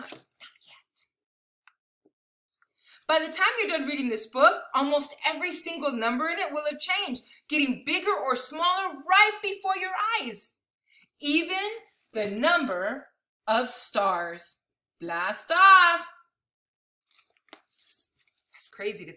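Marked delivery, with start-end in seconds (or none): 3.1-14.6 animated, emphatic picture-book reading; big numbers stretched out for effect; read-aloud to children. Acted. Reading a picture book of amazing numbers to children, animated storytelling. 15.0-16.1 loud, punched countdown-style call; launch call. Acted. Reader sending the stars off for children, loud launch call. Rocket launch exclamation in book.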